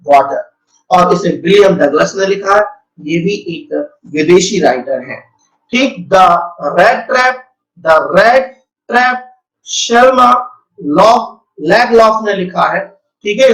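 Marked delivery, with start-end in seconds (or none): none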